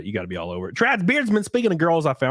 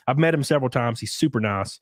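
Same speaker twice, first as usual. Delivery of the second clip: usual speaking voice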